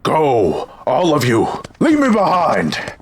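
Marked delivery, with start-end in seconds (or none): 1.8-2.9 high, desperate cry; desperate urging. Acted. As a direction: urge them to abandon you, voice leaping up. Captive urges others to flee.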